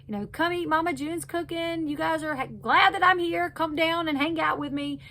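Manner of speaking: high, bright call to come down and eat; mock cheery Mama June. Mother calling her daughters down to breakfast, cheerfully.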